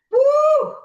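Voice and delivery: excited whoop. Real, not acted. Cutting onions makes her cry.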